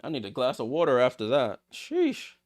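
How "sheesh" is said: a much higher, squeaky exclamation; amazed sheesh. Real, not acted. Streamer reacting to a wild back-and-forth, high amazed exclamation. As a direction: let it pop out in disbelief. Reacting after a frantic sequence.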